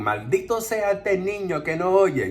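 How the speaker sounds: a hint of an old woman cursing; angry grandma. Imitating the grandma yelling at the boy, mild mock yell. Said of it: "abuela's yelling and screaming".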